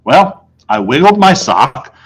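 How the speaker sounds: usual speaking voice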